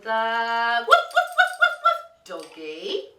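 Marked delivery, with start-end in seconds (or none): 1.2-2.0 rapid high-pitched woofs; playful dog barks. Pretend. Storyteller finding the toy dog in the bag, high yapping. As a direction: yap like an excited little dog.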